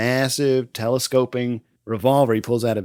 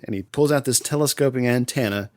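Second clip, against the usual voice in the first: normal talking voice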